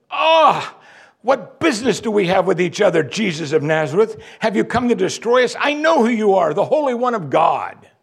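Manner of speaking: high, loud tormented demon voice; a demon crying out at Jesus; exasperated demon. Acted. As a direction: demon cornered and shrieking. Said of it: "He's exasperated".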